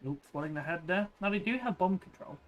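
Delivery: loud, fast live play-by-play; esports caster. Acted. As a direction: call the action as it happens.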